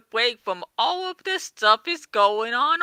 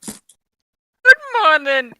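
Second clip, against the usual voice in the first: character voice; 3 semitones higher, 7 dB louder, much slower than usual. Acted. Friends voicing characters in story.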